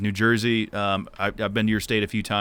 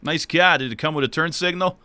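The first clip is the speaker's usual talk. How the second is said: higher-pitched New England accent; 6 semitones higher, 3 dB louder than usual. Shown as a demonstration. Host reads favorite road sign on request, New England accent. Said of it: "it would be my New England accent".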